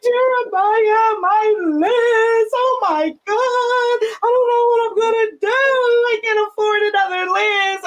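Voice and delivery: high distressed wail; hammed-up high lament; retold wail to son. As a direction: wail to your son about the broken lens, pitched high. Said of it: "I'm like".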